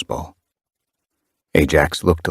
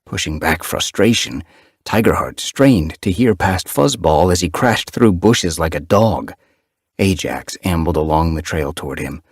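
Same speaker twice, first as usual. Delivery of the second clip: usual speaking voice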